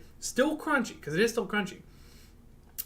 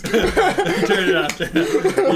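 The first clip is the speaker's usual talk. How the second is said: laughing; 9 dB louder, slower than usual. Real, not acted. Laughing, begging to stop.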